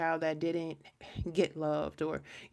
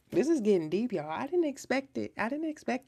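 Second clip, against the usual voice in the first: higher and louder, caught off guard; real surprise; 7 semitones higher, 4 dB louder than usual. Real, not acted. Reading turns unexpectedly deep, exclaims.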